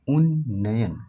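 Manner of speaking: उन्नयन with a long doubled न, said loud; sounding it out. Shown as a demonstration. Teacher sounds out the word to test spelling. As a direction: stretch the न in उन्नयन. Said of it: "उच्चारण करके देखेंगे".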